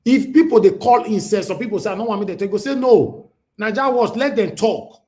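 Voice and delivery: high, loud closing rant; rally the listeners loudly; shouting defiance at critics; fired-up shout. Real, not acted.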